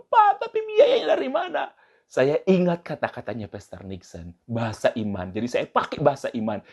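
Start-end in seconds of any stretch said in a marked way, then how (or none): 0.1-1.7 high-pitched comic wail of a wife; mock crying. Wife pleading with husband about the cost, mock crying. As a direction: cry it out in an exaggerated plea. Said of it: "Istrinya katanya nangis".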